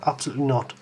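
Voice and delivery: ordinary talk, nothing marked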